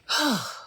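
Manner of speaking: emotional sigh, letting it out; sigh to catch breath. Real, not acted. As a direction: pause and breathe out audibly. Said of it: "Let me breathe".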